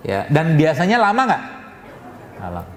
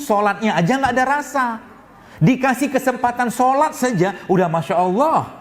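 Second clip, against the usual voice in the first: raised pitch, driving sermon delivery; fiery preaching; 5 semitones higher than usual. Acted. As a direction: preach it with rising fervour. Preacher builds to fervour. (ceramah berapi-api dengan nada tinggi)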